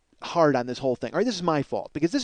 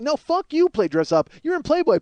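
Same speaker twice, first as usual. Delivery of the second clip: higher, louder mocking rant at celebrities; 8 semitones higher, 3 dB louder than usual. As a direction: tear into them in a mock rage. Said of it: "And it's like".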